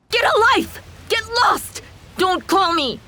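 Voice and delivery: high, angry shouts; angry outburst. Acted. Fed-up wife ends a call with her husband, angry outburst. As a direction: throw each command out in exasperation.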